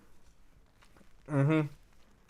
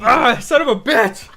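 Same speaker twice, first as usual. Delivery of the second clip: pained laugh; 8 semitones higher, 7 dB louder than usual. Real, not acted. Burning pain, half laughing.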